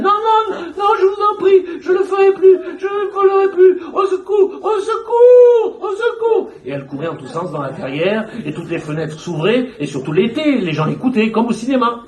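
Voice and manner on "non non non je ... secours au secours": very high, loud terrified cries; screaming for help. Retold or imitated. Madwoman begging imagined attackers, screaming high in terror. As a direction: shriek for help, begging them to stop.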